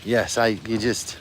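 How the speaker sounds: ordinary talk, nothing marked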